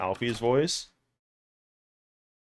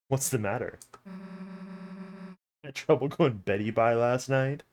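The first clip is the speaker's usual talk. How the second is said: lightly voiced mocking bully. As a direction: sneer at the sleepy kid. Bully character teases Chris.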